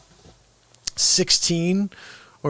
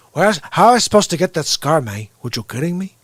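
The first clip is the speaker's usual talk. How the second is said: put-on Scarface Cuban accent; 5 dB louder than usual. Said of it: "And he goes".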